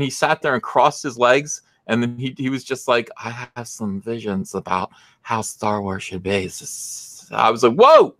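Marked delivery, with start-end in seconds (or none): 3.2-6.4 soft, gentle lisping imitation; mocking soft-spoken lisp. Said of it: "I don't like the lisp". 7.7-8.1 high, loud burst of shock; acted-out alarmed exclamation; startled whoa. Retelling own startled reaction.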